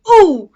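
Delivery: high, very loud whoop falling in pitch; loud excited "ooh". Real, not acted. Reacting to a big play.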